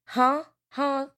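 high, incredulous "huh"; puzzled disbelief; baffled huh. Real, not acted. Baffled at eating belts.

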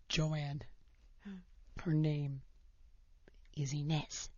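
quiet, low confiding voice; hushed aside. Retold or imitated. Aunt taking the mother aside to break the news, hushed. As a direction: pull her aside and say it under your breath.

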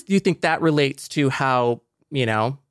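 normal talking voice

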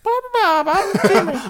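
mocking impression. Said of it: "And then Jimmy was like".

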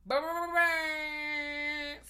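slow, high alarm buzz; alarm sound. Pretend. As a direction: drag it out like a warning siren. Spoiler alarm noise.

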